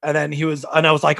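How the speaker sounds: usual speaking voice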